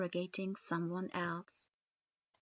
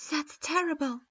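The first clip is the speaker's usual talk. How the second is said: ordinary delivery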